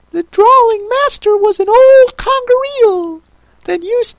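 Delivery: very high tearful creature voice; Mock Turtle voice. A narrator's reading.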